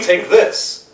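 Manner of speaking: normal talking voice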